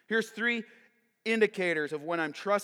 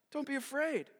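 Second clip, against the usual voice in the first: calm reassurance; 6 semitones higher, 6 dB quieter than usual. Acted. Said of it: "Elisha calmly declares".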